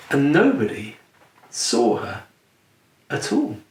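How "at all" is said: usual speaking voice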